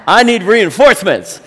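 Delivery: loud, laughing call for backup; rallying the elders. Retold or imitated. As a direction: round up the troops, half joking. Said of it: "and I say".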